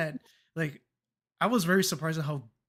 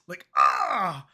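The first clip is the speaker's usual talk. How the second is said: pained cry; 5 dB louder than usual. Retold or imitated. Reacting to drink price.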